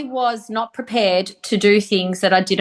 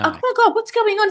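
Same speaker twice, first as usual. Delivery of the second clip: high-pitched, acted alarm; retold baffled panic; bewildered; 13 semitones higher than usual. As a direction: squeal it as if totally lost. Retelling own bewildered panic.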